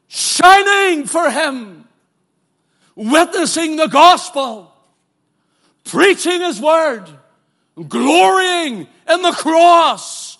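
loud, rising preacher's cry, stretched on shining for him; preaching at full force. Acted. Preacher to congregation on facing the Savior, full-force preaching. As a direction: preach it out, swelling to a shout.

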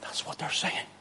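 near-whisper after a climax; hushed aside. Acted. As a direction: drop right down to an awed whisper.

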